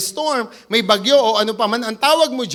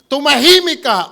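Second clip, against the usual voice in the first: louder, firm command; 4 semitones higher, 8 dB louder than usual. Retold or imitated. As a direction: silence the sea. Jesus commanding the waves. (malakas at mariing utos na tumahimik)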